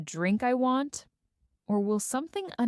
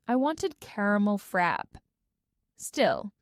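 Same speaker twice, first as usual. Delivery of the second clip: usual speaking voice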